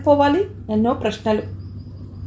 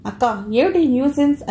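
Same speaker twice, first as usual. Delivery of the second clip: ordinary delivery, nothing marked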